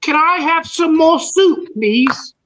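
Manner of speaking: put-on high-pitched Oliver Twist English accent. Oliver Twist begging a gentleman for soup, English accent. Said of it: "practicing your English accent?".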